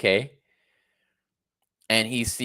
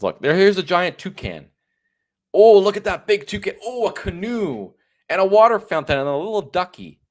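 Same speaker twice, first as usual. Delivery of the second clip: much louder and higher, fast fake awe; mock gushing; 7 semitones higher, 7 dB louder than usual. Mocking the Vancouver tourist, a loud squealing fake awe. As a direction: squeal over the toucan like a child.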